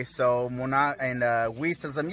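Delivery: ordinary delivery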